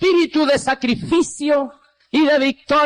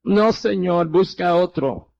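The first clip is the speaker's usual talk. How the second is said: lower, subdued mock-tearful plea; negativa llorosa retenida; 7 semitones lower than usual. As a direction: retell a tearful refusal, low and restrained. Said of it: "pero llorando". (negativa llorosa, contenida)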